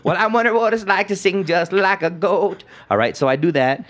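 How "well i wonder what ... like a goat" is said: high bleating goat-like singer voice; mock the singer as a bleating goat, pitched up. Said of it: "doing his goat voice".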